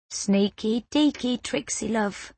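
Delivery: sing-song, fading soft; playful teasing boy. A narrator's reading. Boy teasing the girl who stole his wing, sing-song. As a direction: tease her with a lilting rhyme.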